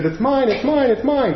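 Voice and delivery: high squeaky cartoon greed; little high-pitched voice. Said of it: "this little high-pitched voice".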